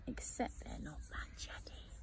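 hushed whisper for the punchline; whispered aside. A narrator's reading. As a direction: drop to a secret whisper. Spooky aside about silent neighbours.

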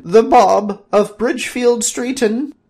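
high, loud, slow court proclamation; grand announcement. Acted. Courtiers presenting Bob to the king, loud slow proclamation. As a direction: present the champion with fanfare.

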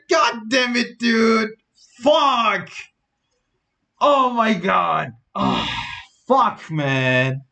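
furious yelling. Real, not acted. After the loss.

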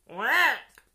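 loud quack; loud playful quack; duck quack. Acted. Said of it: "said the duck".

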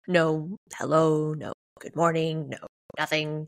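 usual speaking voice

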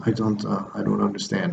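soft, hesitant "I don't understand"; quiet confused reply. Retold or imitated. Interpreter confused, retold.

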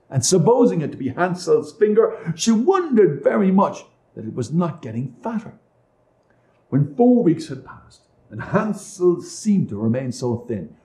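ordinary delivery

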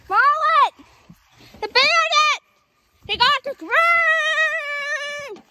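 high alarmed yell; shouting. Pretend. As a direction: shout the alarm across the field. Alarm about bandit.

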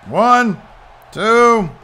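excited count. Real, not acted. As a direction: count it out like a hyped fan.

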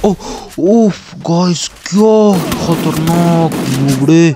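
stunned. Real, not acted. Shocked by enemy headshot.

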